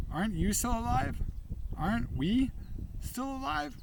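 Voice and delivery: high, rising appeal; pleading question. A narrator's reading. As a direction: plead upward, voice climbing. Narrator pleads with Bear.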